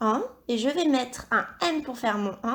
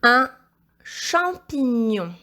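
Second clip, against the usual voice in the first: slow, drawn-out whole word; dictation; 6 dB louder, much slower than usual. Shown as a demonstration. Teacher dictating second word to pupil, slow dictation. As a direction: dictate "Un champignon" slowly as one phrase.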